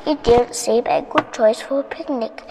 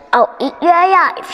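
higher-pitched storybook threat; threatening witch; 6 semitones higher, 4 dB louder than usual. A narrator's reading. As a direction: menace him as the witch, voice raised. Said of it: "she hissed".

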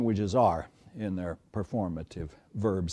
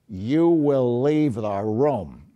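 slow, louder directive, each word weighted; stern command, slow and loud; 6 dB louder, much slower than usual. Shown as a demonstration. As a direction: lay it down as an order, implying or else. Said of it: "marked as a directive by the tone of voice".

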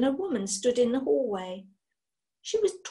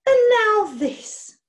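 slow, loud, higher sighing reproach; hurt witch sighing at Daniel; 10 semitones higher, 8 dB louder, slower than usual. Acted. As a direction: let it fall out on a wounded sigh. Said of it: "she sighed".

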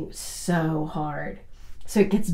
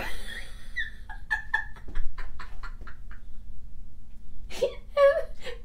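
a real high laugh trailing off; high breathy laugh; 6 dB quieter than usual. Real, not acted. Laughing while telling a friend about the puppy. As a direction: laugh helplessly at the memory.

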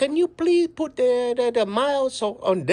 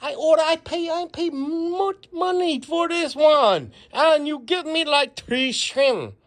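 complaining customer in a mock Chinese accent; 4 semitones higher than usual. Customer complaining about small shrimp, in a Chinese accent. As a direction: grumble through a put-on Chinese accent. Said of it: "we're gonna do a Chinese accent".

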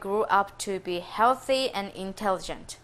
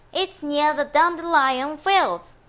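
tiny ant voice; 5 semitones higher, 4 dB louder than usual. Acted. The ant answers him.

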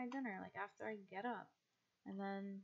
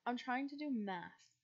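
ordinary talk, nothing marked

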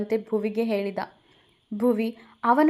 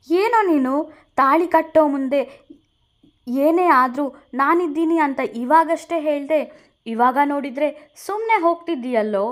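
higher and louder, protesting; indignant outburst; 5 semitones higher, 5 dB louder than usual. Acted. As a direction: stop him with an indignant cry. Veda stops leaving friend. (ಜೋರಾಗಿ ಆಕ್ಷೇಪಿಸುವ ಧ್ವನಿ)

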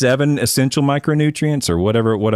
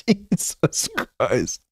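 breathless laugh; 4 semitones higher, 6 dB quieter, much slower than usual. Real, not acted. Wheezing after laughing.

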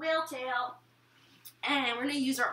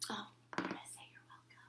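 whispering; 3 semitones lower, 19 dB quieter, much faster than usual. Real, not acted. Aside before line.

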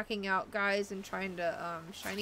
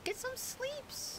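much higher, slow and softer, cutesy; silly baby voice; 6 dB quieter, much slower than usual. Pretend. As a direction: draw it out like a sleepy cartoon. Silly repeat of sleep reminder.

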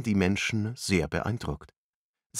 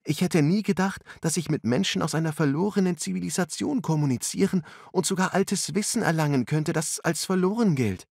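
put-on much higher, quick girl's voice; amazed young woman; 10 semitones higher than usual. A narrator's reading. Angela telling friends about ancient knowledge, high and excited. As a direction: gush with wonder.

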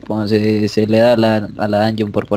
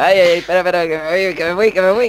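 being chased; 5 semitones higher, 4 dB louder, much faster than usual. Real, not acted. Fleeing in game.

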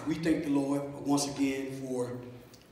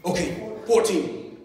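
normal talking voice

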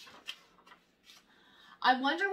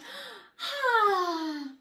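breath out; 6 semitones higher, 3 dB louder than usual. A narrator's reading. Lets the air out.

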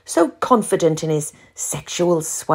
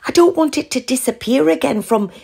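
higher, begging friend; pleading; 6 semitones higher than usual. A narrator's reading. Val pleading with Ruth on the phone for help. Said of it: "Val pleaded".

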